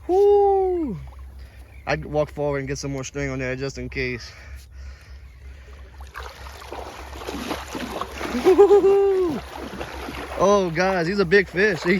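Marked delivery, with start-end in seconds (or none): 0.1-1.0 high loud cheer; excited whoop. Real, not acted. Cheering as fish fights. 4.4-10.4 high airy laughter; long breathy laugh. Real, not acted. Laughing during the fight.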